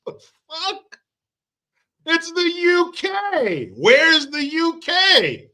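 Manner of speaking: very high, slow indignant squeal; a shocked Brit losing his country; mock outraged Brit. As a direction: squeal it in disbelief.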